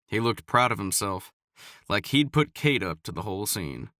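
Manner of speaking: ordinary delivery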